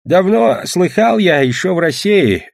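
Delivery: put-on slow, high old peasant voice; old grandfather. A narrator's reading. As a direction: begin a tale slowly in a high grandad voice. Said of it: "заговорил дед".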